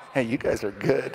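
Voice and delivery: ordinary talk, nothing marked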